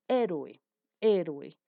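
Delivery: "héroe" with a strong first syllable, falling; teacher's model "héroe", stressed on é; clear syllable demo. Shown as a demonstration. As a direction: say "héroe" firmly, punching the HÉ. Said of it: "también tres explosiones de voz".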